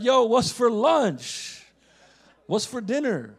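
put-on slow, drawn-out street-ball accent; imitated basketball-buddies accent. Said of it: "I'll go home with the accent".

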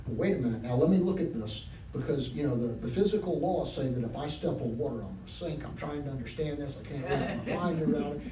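low, quiet, hesitant Peter, speeding up as he frets; voicing doubting Peter. Preacher voicing Peter weighing the water, hesitant. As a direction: talk yourself out of it, low and fast.

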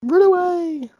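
hammed-up panicked churchgoer, rising to a high squeal; mock self-righteous zealot. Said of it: "that whole sort of self-righteous".

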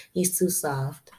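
ordinary talk

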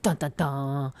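loud mock suspense sting; dramatic sting. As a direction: sing out a dun-dun-dun cliffhanger cue.

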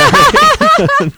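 loud high laugh; burst of laughter. Real, not acted. Bursts out laughing mid-song.

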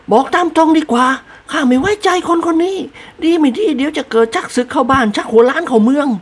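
high blunt mistress voice; bossy noblewoman orders the servant; blunt lady. A narrator's reading. Lady bluntly orders the servant to get rid of the guest. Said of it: "พูดโคร่ง".

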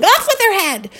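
high, loud mock royal shout; yelling Queen. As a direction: bellow it like the Queen of Hearts. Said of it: "the Queen and Alice in Wonderland always yelling".

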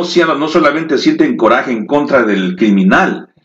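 ordinary delivery

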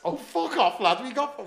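high, loud protest; mock outburst. Friends protesting to the laughing crowd, loudly. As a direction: shout it off, half laughing. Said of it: "we mate went".

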